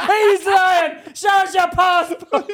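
put-on loud, high English-accented heckle. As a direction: shout the accusation in an English accent. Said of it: "slip into my English accent and be like".

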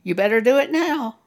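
loud, fast, pressing demand; pushy devil voice. Retold or imitated. Devil pressuring the listener, loud and rushed. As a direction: drive and push like a bullying devil. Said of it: "That devil will say".

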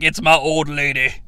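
low, mocking guy saying old lady; lightly voiced sneer at the phrase; a hint of a dismissive boyfriend; mocking dude voice. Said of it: "when someone's like".

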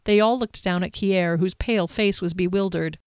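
ordinary delivery, nothing marked

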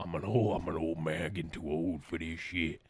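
put-on low, tired old man voice; weary old man. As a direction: grumble it like a worn-out veteran cop. Tired old man movie quote.

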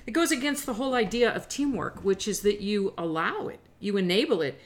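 ordinary talk, nothing marked

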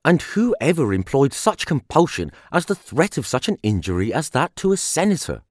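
ordinary delivery, nothing marked

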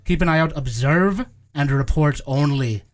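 doting gnomish teacher giving orders; gnome teacher voice. Acted. DM voicing teacher Isadora.

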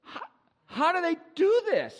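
astonished at the VR ocean; amazed wonder. Retold or imitated. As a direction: gape at the ocean video. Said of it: "I'm like".

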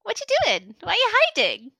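put-on high, curious little girl voice; a small child popping up, nosy and bright; orc child voice. GM voicing a curious orc child to the players. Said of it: "you hear a voice behind you going".

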